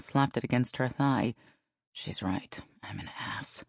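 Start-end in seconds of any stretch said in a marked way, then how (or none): 1.9-3.6 low, very soft self-reproach; hushed grumble to oneself; muttered. A narrator's reading. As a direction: mutter it under your breath. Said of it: "she muttered under her breath".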